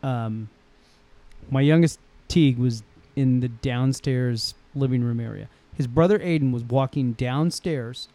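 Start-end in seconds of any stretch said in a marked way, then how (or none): none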